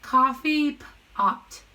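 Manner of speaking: Coffee pot stretched slowly, word by word; slowly sounded out. Shown as a demonstration. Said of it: "let's sound it out together".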